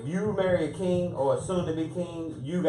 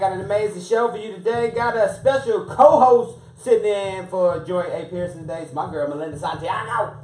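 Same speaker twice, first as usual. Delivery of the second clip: radio host intro; 7 semitones higher, 8 dB louder than usual. Acted. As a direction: open the show like a DJ. Radio show opening.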